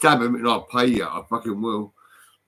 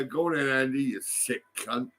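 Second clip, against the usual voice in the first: normal talking voice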